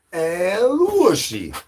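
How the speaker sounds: high drawn-out call. Acted.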